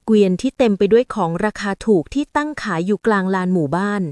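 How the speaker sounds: ordinary delivery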